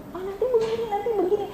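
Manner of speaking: high, soft fretting voice; mock worrying. Teacher mimics teens imagining the worst, mock worrying. Voicing anxious overthinking before facing it.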